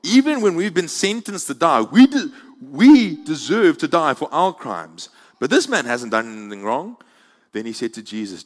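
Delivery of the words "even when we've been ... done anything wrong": loud, pleading protest. A narrator's reading. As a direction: rebuke the other criminal loudly.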